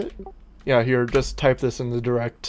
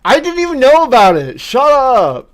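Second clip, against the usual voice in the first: much higher, louder and fast; loud defensive outburst; 11 semitones higher, 12 dB louder than usual. Real, not acted. Gamer loudly defends himself to friend. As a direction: blurt it out to cut him off.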